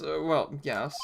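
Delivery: ordinary talk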